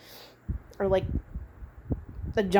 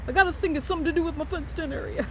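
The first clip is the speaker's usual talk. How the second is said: Billy Bob Thornton impression; 8 semitones higher, faster than usual. Vlogger quoting an SNL sketch to viewers, high mock Southern voice. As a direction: do the SNL fenced-in area bit. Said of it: "Remember that Saturday Night Live sketch with Billy Bob Thornton?".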